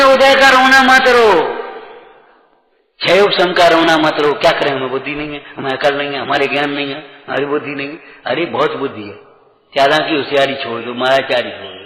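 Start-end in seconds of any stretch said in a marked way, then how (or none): 4.3-8.0 lower, softer mock complaint; mock whining excuse. Preacher mimics excuse-making listeners, lower and softer. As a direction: whine the excuse half-mocking.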